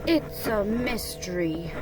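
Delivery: slow, soft musing; Pooh, musing. Acted. Pooh pondering puzzling presents, slow and soft. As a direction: draw it out softly.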